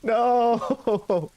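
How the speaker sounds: high, drawn-out wail of protest; long, high mock-despairing no; comic dismay. Real, not acted. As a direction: cry out at being abandoned. Dismay at being left alone.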